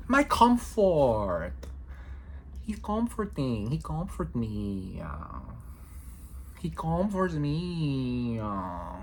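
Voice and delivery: slow, drawn-out mock Mexican accent; put-on Mexican accent. Musing on offering comfort, slow put-on Mexican accent. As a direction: playfully stretch it out in a loose Mexican accent. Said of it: "putting on a Mexican accent".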